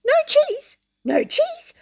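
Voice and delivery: high, loud shocked yell; shrill shocked yell of a cheated mouse. Acted. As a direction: yell it in disbelief. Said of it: "Hem yelled".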